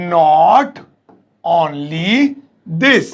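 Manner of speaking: each word set apart, slow. Shown as a demonstration. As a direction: teach it word by word. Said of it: "इतना ही नहीं". (एक-एक शब्द धीरे-धीरे)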